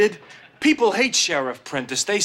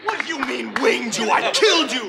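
excited squabble; 12 semitones higher than usual. Real, not acted. Boys squabbling over pretend shootout.

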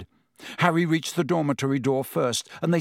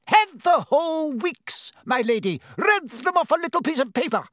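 high, gallant boasting, quickening at the end; proud; 13 semitones higher, 3 dB louder than usual. A narrator's reading. Painted knight boasting to the professor, proud. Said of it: "said Sir Cadogan proudly".

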